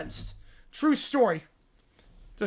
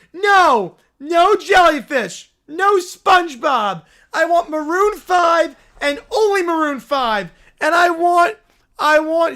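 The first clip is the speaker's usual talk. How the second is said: louder, higher demanding brat; stomping tantrum yell; 4 semitones higher, 6 dB louder, slower than usual. Acted. [name] demanding only Maroon five, tantrum yell. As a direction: bark demands like a spoiled child.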